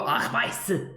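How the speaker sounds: arbeise said the French way, dropping the end; French accent on arbeise. Shown as a demonstration. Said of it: "you would pronounce it".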